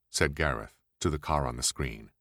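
plain reading voice

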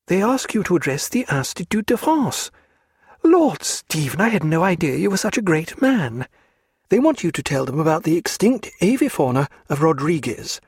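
a spirited lady mocking her hostess; Diana's voice. A narrator's reading.

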